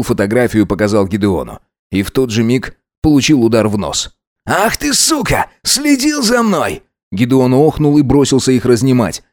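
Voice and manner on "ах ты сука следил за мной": high, drawn-out furious cry; enraged. A narrator's reading. Alex attacks Max for exposing him, enraged. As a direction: cry it out high, outraged at being spied on.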